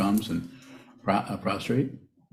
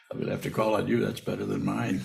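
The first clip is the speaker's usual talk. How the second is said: ordinary talk, nothing marked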